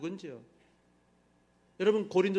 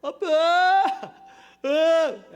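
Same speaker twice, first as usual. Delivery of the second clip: much higher and louder, a child's put-on whine; fake crying child; 7 semitones higher, 8 dB louder, much slower than usual. A father imitating his child's fake crying to the congregation. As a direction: ham up a kid pretending to cry for dad. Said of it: "목소리를 막 위조해요".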